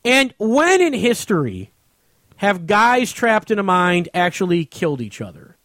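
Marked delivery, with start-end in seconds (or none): none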